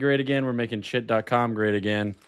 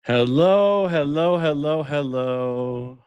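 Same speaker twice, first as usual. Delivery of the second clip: calling out; 5 semitones higher than usual. Acted. Calling out, fading.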